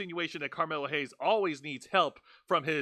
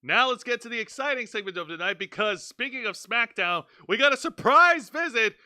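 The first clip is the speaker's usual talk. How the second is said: hyped announcer; 6 semitones higher, 6 dB louder than usual. Real, not acted. Hyping up a segment.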